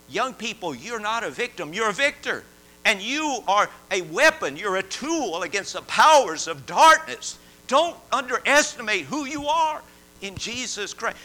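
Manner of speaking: loud, urgent pulpit call; forceful preaching. Acted. Preacher to young people, loud urgent exhortation. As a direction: drive the call home to the congregation.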